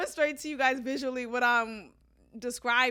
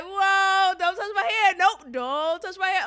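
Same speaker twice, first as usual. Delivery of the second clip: higher and louder, laughing refusal; loud high protest; 7 semitones higher, 8 dB louder than usual. Retold or imitated. To a coworker reaching for her hair, loud high refusal. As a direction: fend him off with a loud no. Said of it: "Like, I'm doing that".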